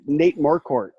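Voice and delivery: ordinary talk, nothing marked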